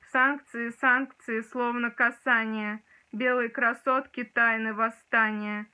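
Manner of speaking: reciting a poem with dramatic force; dramatic poetry declamation. Shown as a demonstration. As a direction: declaim it like on stage. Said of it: "сейчас тоже его прочитаю".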